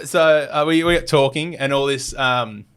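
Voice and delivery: normal talking voice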